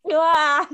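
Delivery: short loud laughing "ah"; cringing yell. Retold or imitated. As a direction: yelp in embarrassed cringe. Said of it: "the cringe of my yells and screams".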